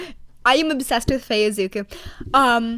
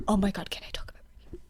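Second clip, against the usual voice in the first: fast secretive whisper; a gossipy aside; 4 semitones lower, 11 dB quieter, faster than usual. Real, not acted. Conspiratorial aside about rink drama.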